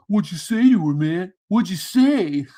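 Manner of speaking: lightly voiced whiny guys demanding; mock orbiters. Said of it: "the orbiters are like".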